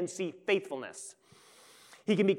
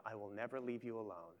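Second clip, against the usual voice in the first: much softer, fading almost to a breath; hushed tender promise; 7 semitones lower, 15 dB quieter than usual. Acted. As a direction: let the promise die away into a hush. Voiced Jesus fades to hush.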